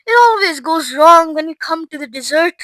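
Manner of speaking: usual speaking voice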